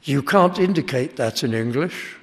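ordinary talk, nothing marked

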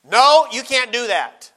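loud, drawn-out firm no; firm refusal. Acted. Preacher acts out telling his own sinful flesh no, firm and loud. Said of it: "used to hearing you say no to it".

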